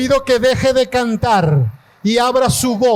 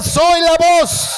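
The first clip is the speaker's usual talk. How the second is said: higher, slow declaration of authority; 6 semitones higher, slower than usual. Shown as a demonstration. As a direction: say it like you own it. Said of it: "Dígalo con autoridad". (con autoridad, más agudo y lento)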